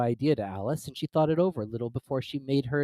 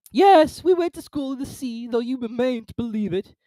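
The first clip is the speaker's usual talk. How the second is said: high, loud tearful voice; hammed-up tragic turtle; mournful Mock Turtle; 13 semitones higher, 8 dB louder than usual. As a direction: tell it like a weepy old turtle.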